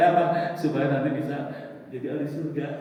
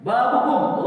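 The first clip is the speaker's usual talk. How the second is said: calling out; 9 semitones higher, 9 dB louder, much slower than usual. Acted. Angel calls at heaven's gate.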